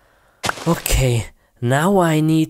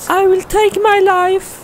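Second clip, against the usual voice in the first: high put-on woman's cry; horrified girlfriend; 4 dB louder than usual. [name] shouting at Steve, horrified. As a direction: accuse him like a frightened girlfriend.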